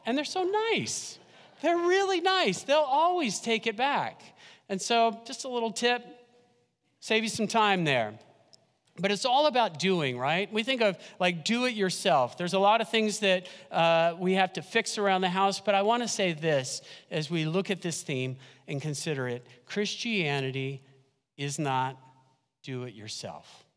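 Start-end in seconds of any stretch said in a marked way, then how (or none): 0.0-4.2 gushing. Acted. Gushing about store staff. 22.6-23.7 hushed. Acted. Key sermon line, hushed.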